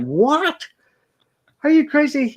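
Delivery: the cop can't believe his ears; cop's disbelief. Acted. Cop reacts in joke.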